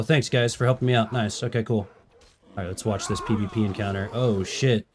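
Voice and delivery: ordinary talk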